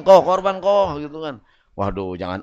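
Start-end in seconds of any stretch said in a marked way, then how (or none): 0.0-1.0 higher, louder youth calling a shopkeeper-style "Koh". Acted. Mosque youth asking a "Koh" to join qurban, calling out. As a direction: call out an eager appeal. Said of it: "ada remaja masjid datang".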